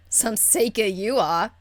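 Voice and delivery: ordinary delivery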